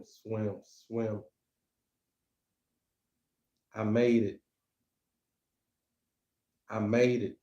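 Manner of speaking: measured spoken-word delivery, long pauses between lines; slow, deliberate poem reading; poetry recitation. Acted. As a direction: recite your poem line by line, letting each one land. Reads own poem aloud.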